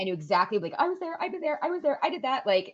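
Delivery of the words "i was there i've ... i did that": bright, high, eager "I was there"; excited recollection. Retold or imitated. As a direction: gush about having been there. Excited retelling of being in Italy.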